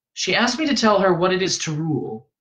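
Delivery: put-on earnest young pupil; young Paul, earnest. A narrator's reading. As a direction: recite it earnestly in a lifted voice. Young Paul recounts the lesson.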